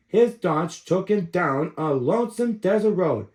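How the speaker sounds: usual speaking voice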